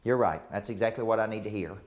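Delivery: low, settled concession; imitating a client who comes round; acted agreement. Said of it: "sometimes they'll go".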